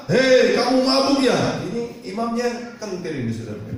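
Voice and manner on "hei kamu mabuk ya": high, drawn-out accusing call; priest's challenge. Retold or imitated. Imam Eli challenges weeping Hana, in a high slow call. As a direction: call out the question slowly as a challenge.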